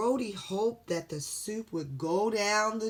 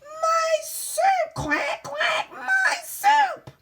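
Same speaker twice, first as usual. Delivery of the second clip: wailing duck chef; 9 dB louder, much slower than usual. Acted.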